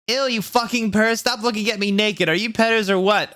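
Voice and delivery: high mock-disgusted yell; screamed. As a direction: yell at the peepers, grossed out. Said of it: "I screamed".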